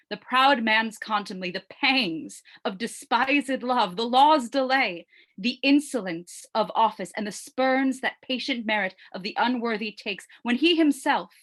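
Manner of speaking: impassioned stage tirade, rising in force; building indignation. Acted. Hamlet listing life's wrongs, building.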